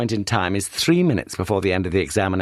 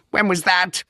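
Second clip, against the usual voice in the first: whiplash voice; 10 semitones higher than usual. Acted. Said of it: "The Governor's voice was a whiplash now".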